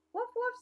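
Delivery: high, playful woof woof; mock dog barks. Dog answers the duck, high playful woofs. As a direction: bark like a puppy.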